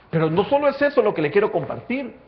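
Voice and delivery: loud, rising sermon climax; preaching at full force. Acted. Preacher building the sinking scene for the church, full force. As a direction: drive the story home to the congregation.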